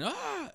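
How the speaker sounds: sudden high laughing yelp; a quick shocked cry through a laugh; retold shriek of surprise; startled yelp. Retold girlfriend scream from vomit.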